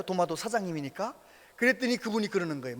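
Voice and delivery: usual speaking voice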